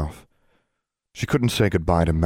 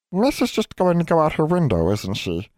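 knowing house elf; 11 semitones higher than usual. Acted. House elf Nina catching Alex's window plan. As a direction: needle her like a knowing little elf.

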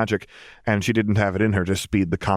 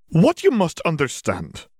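slow, higher-pitched formal witch; McGonagall, grave explanation; 9 semitones higher, slower than usual. A narrator's reading. Witch preparing parents for war news, slow and serious. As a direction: begin a serious explanation, slowly.

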